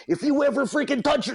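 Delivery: high, loud mock gangster threat; hammed-up movie tough guy; Goodfellas threat. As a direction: threaten like Ray Liotta. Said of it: "he walks across and he goes".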